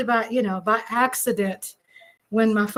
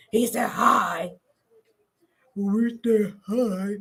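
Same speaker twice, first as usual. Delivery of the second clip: lower, drawn-out put-on greeting; mimicking him; 5 semitones lower, slower than usual. Showing viewers how husband said hi, low mimicry. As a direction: imitate a man's slow, low hello. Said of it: "I'm just mimicking him".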